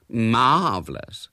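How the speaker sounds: slow, drawn-out "Marvellous"; loud, languid gush on "Marvellous". A narrator's reading. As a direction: stretch the first syllable of "Marvellous" admiringly. First of three rising gushes.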